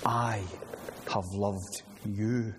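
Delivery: quiet, slow marvelling over I have loved you; hushed in wonder. Shown as a demonstration. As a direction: let I hang, then breathe have loved you softly and slowly. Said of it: "Now listen to it and marvel again".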